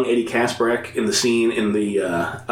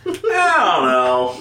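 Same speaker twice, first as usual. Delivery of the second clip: higher, very drawn-out noncommittal quote; mock uncertainty; 5 semitones higher, 3 dB louder, much slower than usual. Reviewer quoting own reaction to bad effects, mock-doubtful. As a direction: stretch it out, comically unconvinced.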